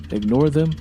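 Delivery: ordinary delivery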